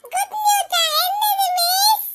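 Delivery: high, eager kiddie question; excited schoolchild. Acted. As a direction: ask miss in giddy anticipation. Pupils hear of good news.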